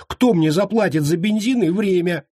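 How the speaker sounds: high, aggrieved whine; whining. A narrator's reading. Friend wanting his petrol paid for, whining. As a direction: whine upward about being short-changed. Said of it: "заныл тот".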